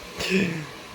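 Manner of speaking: sharp real gasp of surprise; a quick startled intake of breath. Real, not acted. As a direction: gasp as the water tips over. Water spills mid-recording.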